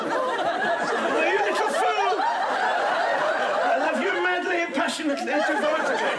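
loud, high, overwrought declaration; hammy passionate shout. Acted. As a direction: bellow it like a stage lover. Acting the love scene.

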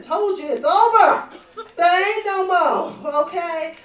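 shouted, slow at the end; loud it's over. Acted. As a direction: yell it at the ex on the doorstep.

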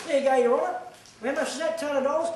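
taunting the judge, loud and high; mock defiant to judge. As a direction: slap the bill down on the judge's bench. Acts out handing judge a bill.

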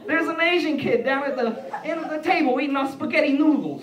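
high, loud mock amazement; hammed-up Italian-American diner; Italian-American guy. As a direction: heckle down the table as an Italian guy. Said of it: "you know, Italian guys".

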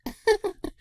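put-on a high girlish giggle. A narrator's reading. Twilight amused by her mother's joke, high giggle. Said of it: "Twilight giggled".

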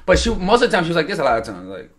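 usual speaking voice

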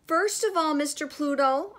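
nervous, high address; shaky voice. A narrator's reading. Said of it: "said in a shaky voice".

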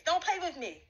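ordinary talk, nothing marked

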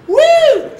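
loud, high-pitched "woo"; excited whoop. Real, not acted. Host wowed by a behind-the-back basket, excited whoop. Cheering the mascot's trick shot.

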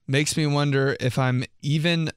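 ordinary talk

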